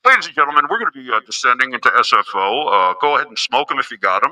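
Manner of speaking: lightly voiced airline captain; pilot announcement. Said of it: "I'm like a pilot on a plane".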